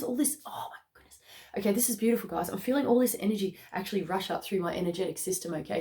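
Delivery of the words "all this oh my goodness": awed whisper. Real, not acted. Awed by the reading.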